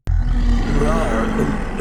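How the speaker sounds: loud roaring dragon growl; huge fiery dragon roar. Pretend. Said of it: "a, a dragon noise".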